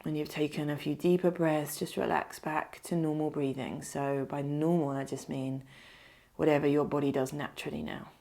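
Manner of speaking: unhurried meditation voice, leaving space to breathe; guided meditation. Acted. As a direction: lead them gently into stillness, phrase by phrase.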